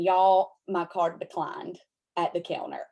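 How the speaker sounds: ordinary talk, nothing marked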